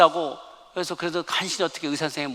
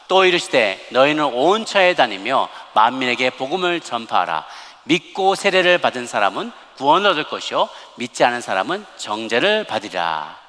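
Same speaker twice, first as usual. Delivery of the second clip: scripture read aloud; 5 dB louder than usual. Acted. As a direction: lead the congregation through the verse, measured and full-voiced. Leads congregation in scripture reading.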